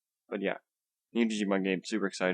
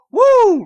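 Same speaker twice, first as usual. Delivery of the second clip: high shrill woo, full volume; forced cheer; 14 dB louder than usual. Shown as a demonstration. Woo to his viewers, whooped at full volume. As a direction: whoop it out like a hype host. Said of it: "I'm trying to do this enthusiastically".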